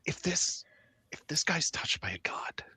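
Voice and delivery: hushed excited whisper. Acted. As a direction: whisper the big idea. Whispered conspiracy between characters.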